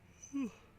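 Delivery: long high sighing breath; real sigh. Real, not acted. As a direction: let out a tired sigh. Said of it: "Excuse me".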